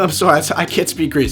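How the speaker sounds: ordinary talk, nothing marked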